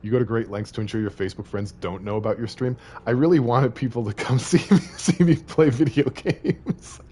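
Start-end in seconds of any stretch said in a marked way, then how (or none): 3.1-7.0 high, loud, breaking into laughter; laughing confession. Real, not acted. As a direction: struggle to get it out through laughs. Laughing while joking about wanting attention.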